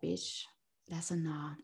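very soft, low aside; muttered dismissal. Retold or imitated. Retelling the character's answer to Maeve, muttered dismissal. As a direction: drop right down for a dry refusal.

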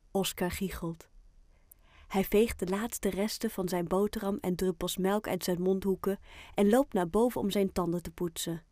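ordinary delivery, nothing marked

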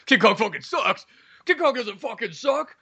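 hammed-up indignation; mock outrage. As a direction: rant like an insulted crazy fan. Imagined crazy stranger takes offence.

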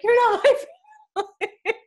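put-on high childhood protest broken by laughter; mock-disown them, cracking up midway; laughing mock disowning. Little girl looking up at the sky, laughingly retold.

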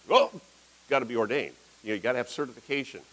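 normal talking voice

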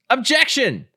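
very high, playful shout to the judge; mock courtroom objection. Player interrupts the mock court, hammed-up objection. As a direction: cry out a hammed-up legal objection.

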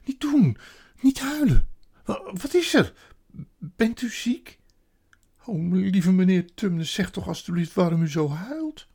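high, loud, urgent pleading; alarmed. A narrator's reading. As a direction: plead with someone in distress. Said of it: "zei Lucie geschrokken".

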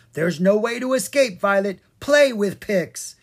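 loud, pleading cry to play; a loud, insistent cry; cried out. Acted. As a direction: call out to Violet, demanding. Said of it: "he cried".

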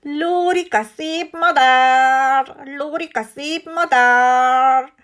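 slow, loud squawking parrot voice; blaring parrot. A narrator's reading. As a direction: blare it out like a vain budgie.

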